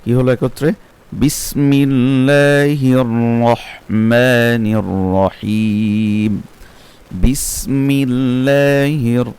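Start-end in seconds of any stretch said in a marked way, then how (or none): 1.1-9.2 slow, chanted tajwid recitation; Quranic recitation. Shown as a demonstration. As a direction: recite in a measured melodic tilawat. (তাজবিদসহ তিলাওয়াত)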